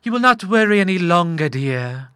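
high, gently drawn mother's voice; reassuring mother. A narrator's reading. Mother comforting her worried son, reassuring. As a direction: soothe your son as his mother. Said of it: "murmured his mother".

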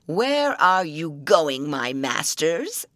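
slow, loud troll voice. Acted. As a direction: growl it out like an old troll.

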